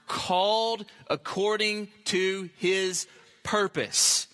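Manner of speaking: CALLED ACCORDING TO HIS PURPOSE spaced out slowly, word by word; slow, weighted "called according to his purpose", each word set apart; deliberate emphasis. Real, not acted. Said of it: "listen to these words".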